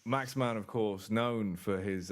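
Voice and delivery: normal talking voice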